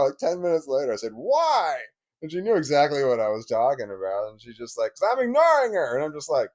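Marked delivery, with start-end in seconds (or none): 1.1-1.8 calling out. Retold or imitated. Same call retold again. 4.9-5.9 imitated kid retort. Daughter's reply, imitated.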